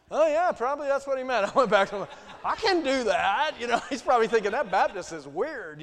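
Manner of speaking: loud, high, laughing through the words; laughing story of the table-blessing mix-up. Real, not acted. Laughing at his own mistake.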